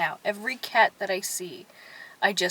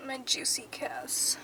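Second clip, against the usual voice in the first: playful whisper; cheeky whispered kiss tag; 3 semitones higher, 8 dB quieter than usual. Real, not acted. After the kiss.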